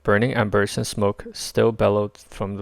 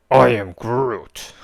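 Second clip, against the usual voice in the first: slow, louder tree creature; tree creature greets the new friend; Groot voice; 6 dB louder, much slower than usual. A narrator's reading. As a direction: stretch it out like a gentle tree.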